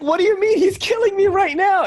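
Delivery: high, loud confused protest; surprised. Retold or imitated. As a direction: exclaim in disbelief. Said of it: "So I'm like".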